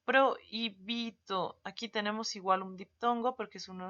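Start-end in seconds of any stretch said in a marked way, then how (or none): 0.1-1.6 "Prohibido" drawn out very slowly, the vowels joined; slow example word. Shown as a demonstration. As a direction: slowly say "Prohibido" so the diphthong is heard. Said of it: "¿Ve?".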